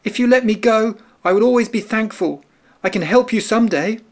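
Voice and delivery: high, earnest mouse voice; small mouse bargaining for its life; little mouse pleading. A narrator's reading. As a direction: promise it up high, like a tiny mouse. Mouse begs the lion.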